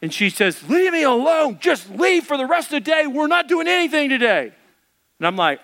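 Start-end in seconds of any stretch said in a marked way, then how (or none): 0.7-4.5 high, hammed-up furious wife; mock angry yell. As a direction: act out her yell from behind the door. Wife yells in retold quarrel.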